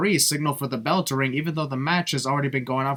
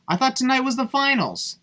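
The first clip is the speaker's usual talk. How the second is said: surprised; 10 semitones higher than usual. Real, not acted. Confused about tournament timing.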